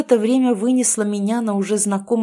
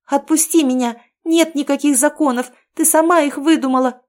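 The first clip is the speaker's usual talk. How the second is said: higher, louder desperate shout; 7 semitones higher, 5 dB louder than usual. A narrator's reading. Captive girl shouts at Aino, desperate and defiant. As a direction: yell it while struggling free. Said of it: "закричала я".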